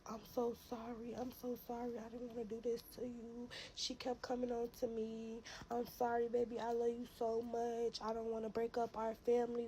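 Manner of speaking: soft, pleading apology, lightly voiced; pleading boyfriend. Baby dad begging her to stay, soft and pleading. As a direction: beg her forgiveness softly.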